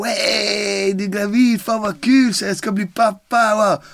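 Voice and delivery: overjoyed. Retold or imitated. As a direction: burst out with joy at becoming a dad. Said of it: "Jag bara". (överlycklig, mycket ljusare röst)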